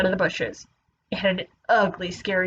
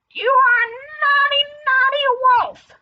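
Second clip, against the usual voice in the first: very high, loud character scolding; angrier scolding turtle; 5 dB louder than usual. Acted. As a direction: squawk the telling-off high and loud.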